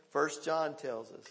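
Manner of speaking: ordinary talk, nothing marked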